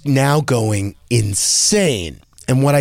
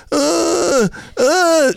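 mock sob sounds, much higher; imitated child whimper. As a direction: whimper like a little boy crying. Crying son in the background.

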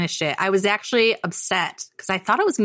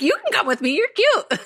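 put-on cooing to toddler; 13 semitones higher than usual. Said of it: "I was like".

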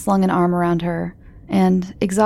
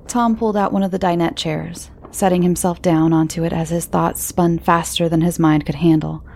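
ordinary delivery

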